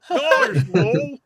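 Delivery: high, loud cartoon Goofy exclamation. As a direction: do Goofy calling out your name. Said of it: "in Goofy's voice".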